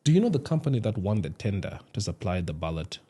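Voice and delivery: hushed, low secret voice; whispering. Real, not acted. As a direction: lean in and whisper it. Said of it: "why are you whispering about that?".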